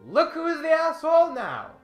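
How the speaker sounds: normal talking voice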